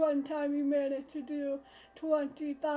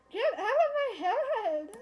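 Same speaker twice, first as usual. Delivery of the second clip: higher-pitched protest; exasperated, pitched-up demand; exasperated outburst; 5 semitones higher, 3 dB louder than usual. Acted. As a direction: protest at having your mind read. Objects to mind reading, acted.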